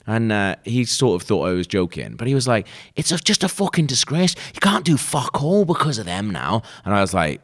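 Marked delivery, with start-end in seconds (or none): 2.9-6.6 high, indignant imitation northern English accent; bad northern accent. Colleague carrying on his complaint, bad northern accent. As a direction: mimic a grumbling northerner, pitched well up. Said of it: "that's not good accent".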